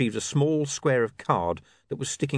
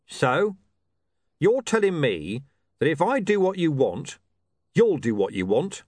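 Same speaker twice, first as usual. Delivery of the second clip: lightly voiced sceptical officer, pitched up; sceptical watch commander; 7 semitones higher, 4 dB louder than usual. Commander questions the reporter's offer, sceptical. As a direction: challenge him, lightly in character. Vimes challenges William.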